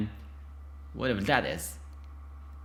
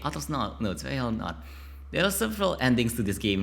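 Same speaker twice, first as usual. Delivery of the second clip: normal talking voice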